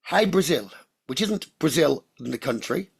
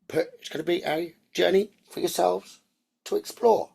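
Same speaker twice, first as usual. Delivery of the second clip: guided meditation; 2 semitones lower, 4 dB quieter than usual. Acted. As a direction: lull them gently toward the island.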